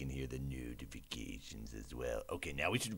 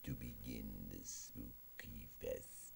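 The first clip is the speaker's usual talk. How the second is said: ordinary delivery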